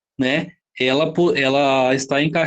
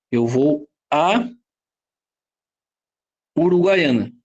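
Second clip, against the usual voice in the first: slow example; 3 semitones lower than usual. Shown as a demonstration. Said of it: "quando eu falo assim".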